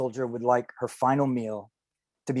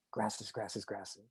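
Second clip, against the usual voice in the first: very soft, low rustle of repeated words; hushed onomatopoeia; 4 semitones lower, 16 dB quieter, much slower than usual. Retold or imitated. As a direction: let the words rustle like grass, barely voiced. Quotes book's onomatopoeic grass passage.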